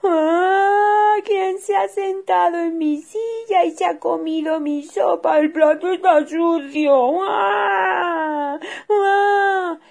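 high, loud crying cub; a little bear bawling over his soup; shrieking child's wail, high and loud. Acted. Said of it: "Osito comenzó a llorar".

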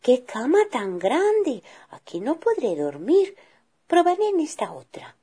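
put-on lilting child's voice, high; little girl voice. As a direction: play a small girl trying out beds.

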